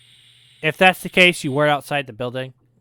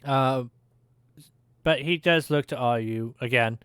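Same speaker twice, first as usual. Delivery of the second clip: normal talking voice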